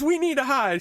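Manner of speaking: very high, squealed mock panic; mock freak-out. Woman freaking out at her friend, mock panic. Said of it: "the woman's freaking the fuck out".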